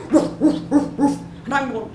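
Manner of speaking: quick, high woofs; imitated dog bark.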